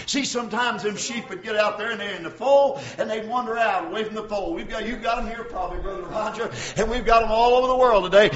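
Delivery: normal talking voice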